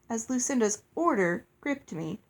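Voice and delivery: ordinary delivery, nothing marked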